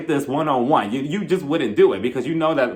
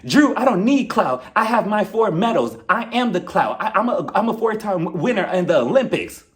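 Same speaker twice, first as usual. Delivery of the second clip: rehearsed loud comeback; 4 semitones higher than usual. Acted. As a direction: deliver the rehearsed line with full bluster. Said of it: "I rehearsed my lines for this fight".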